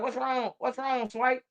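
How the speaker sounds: slightly hammed, high soft question; imitated kids. Kids asking why he flipped, lightly imitated worry.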